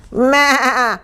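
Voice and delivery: high wavering goat bleat. A narrator's reading. Goat joining the commotion, high bleat. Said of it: "bleated Goat".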